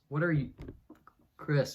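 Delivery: ordinary talk, nothing marked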